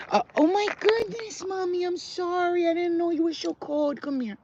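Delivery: high cooing apology to the dog. Real, not acted.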